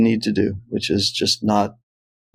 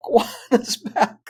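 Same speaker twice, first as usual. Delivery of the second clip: high mock cry through a laugh; high, laughing imitation of a yell; retold yell; 11 semitones higher, faster than usual. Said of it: "yells again".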